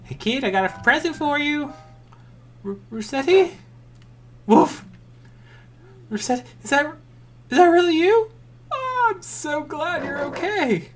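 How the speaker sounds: put-on high, tearful child voice; voicing an excited kid. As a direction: play the kid overjoyed to see his dog.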